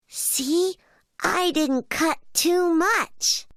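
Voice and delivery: high, slow cub voice; little bear cub. A narrator's reading. Little bear shows his slice of cake, high slow cub voice. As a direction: pitch it up like a small bear.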